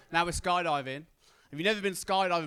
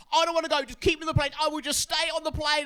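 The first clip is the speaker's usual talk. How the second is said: much higher, louder frantic pleading; mock panicking girl; 11 semitones higher, 5 dB louder than usual. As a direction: beg to stay on the plane. Girl losing her mind on plane.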